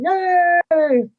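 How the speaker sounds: high, drawn-out crowd cheer. Acted. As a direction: cheer like a delighted crowd of halflings. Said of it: "all the halflings kinda start cheering".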